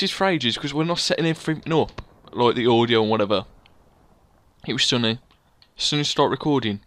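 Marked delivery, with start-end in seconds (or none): none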